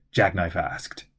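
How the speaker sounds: ordinary delivery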